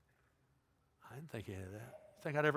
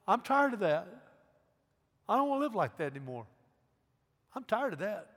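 higher-pitched weary declaration; earnest, high-pitched confession; fed-up plea; 7 semitones higher than usual. Acted. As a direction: voice someone fed up and ready to turn. Voicing the repentant sinner's plea.

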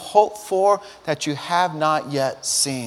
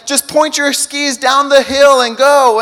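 urging instructor; 7 semitones higher, 8 dB louder, slower than usual. Retold or imitated. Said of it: "the instructor's saying".

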